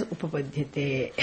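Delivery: "Upapadyate" dropped low and very soft; quiet ending word. Shown as a demonstration. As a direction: let "Upapadyate" fall away almost under the breath. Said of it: "he says".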